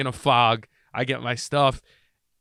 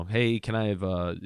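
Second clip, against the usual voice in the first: dragged out; 5 semitones lower, 5 dB quieter than usual. Retold or imitated. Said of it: "I kinda drag it out".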